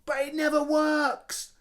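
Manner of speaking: put-on high, loud child's scream of protest. A narrator's reading. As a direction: scream it back at them. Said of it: "screamed Henry".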